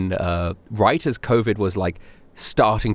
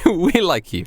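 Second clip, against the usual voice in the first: we like you said higher, as a playful echo; higher mock repeat; 9 semitones higher, slower than usual. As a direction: echo it back in a higher teasing voice. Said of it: "No, I, we".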